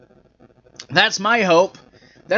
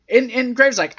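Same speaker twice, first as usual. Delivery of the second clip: ordinary talk, nothing marked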